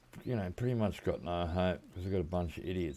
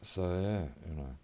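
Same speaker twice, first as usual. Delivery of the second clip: usual speaking voice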